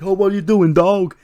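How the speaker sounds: very high, loud yelp of protest; hammed-up indignant yell; mock alarmed shout. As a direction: cry out as if suddenly grabbed. Voicing Truth grabbed from the sand.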